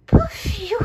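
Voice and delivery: high loud huffing puff of relief; big hot sigh. Acted. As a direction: blow it out as if melting in the heat. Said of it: "Et le pingouin soupira".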